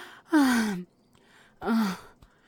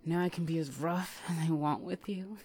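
normal talking voice

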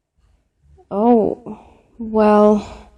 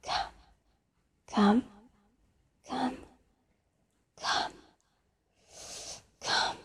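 very soft, breath-light single words; hushed teasing; 3 semitones higher, 15 dB quieter than usual. Acted. Caller teasing the listener on the phone, hushed. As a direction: barely voice each word, leaving long gaps.

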